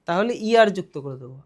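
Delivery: normal talking voice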